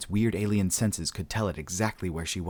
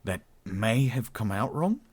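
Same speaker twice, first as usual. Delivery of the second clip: slow, higher-pitched Australian; hesitant Australian backtracking; sheepish admission; 4 semitones higher, slower than usual. A narrator's reading. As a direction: admit it slowly and awkwardly. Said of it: "he admitted".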